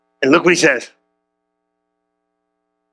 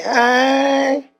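cool Jesus; 10 semitones higher than usual. Acted. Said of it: "Jesus got up all cool".

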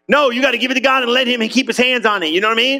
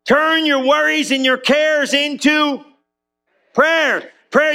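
full-force preaching; 5 semitones higher, slower than usual. Acted.

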